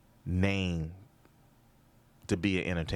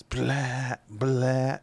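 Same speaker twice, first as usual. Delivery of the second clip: mock gunshot blasts, a bit louder; bad gun impression; 5 semitones higher, 4 dB louder than usual. Shown as a demonstration. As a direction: blast out a clumsy gunshot noise. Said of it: "That's a bad impression of a gun".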